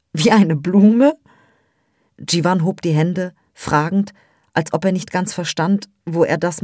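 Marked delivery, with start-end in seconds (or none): 0.1-1.2 slow, louder, giggling disbelief; childish laugh. Acted. Peri laughs back at Jiwan's flower compliment, giggling. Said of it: "Ein kindisches Lachen platzte aus ihr heraus".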